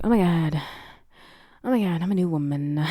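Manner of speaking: hammed-up awestruck character; mock revelation. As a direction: act out a sudden awakening. Character's morning-after revelation, acted.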